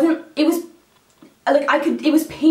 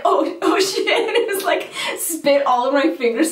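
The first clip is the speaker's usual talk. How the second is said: higher, giggling through the words; laughing; 5 semitones higher than usual. Real, not acted. Telling viewers about the drool mishap, laughing. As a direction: laugh at your own mess. Spit string on tissue, laughing.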